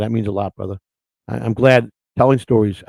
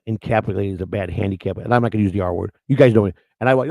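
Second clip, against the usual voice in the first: ordinary talk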